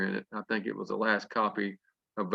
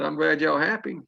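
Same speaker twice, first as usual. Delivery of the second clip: sarcastic; 6 semitones higher, 4 dB louder than usual. Retold or imitated. Sarcastic reply to girl.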